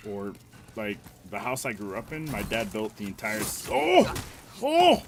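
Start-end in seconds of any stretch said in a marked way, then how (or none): 3.7-5.0 very high, loud yelp of alarm; startled shout. Real, not acted. Streamer cut off by a sudden scare, startled shout. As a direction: jump out of your skin. Sudden shock mid-sentence.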